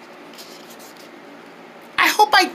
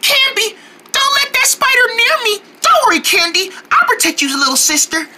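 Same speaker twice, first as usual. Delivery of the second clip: ordinary talk, nothing marked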